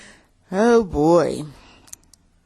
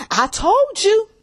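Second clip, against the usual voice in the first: higher, slow, drawn-out told you; higher, drawn-out gloating I told you; 13 semitones higher, slower than usual. Shown as a demonstration. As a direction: savor every word of I told you.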